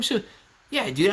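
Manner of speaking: ordinary talk, nothing marked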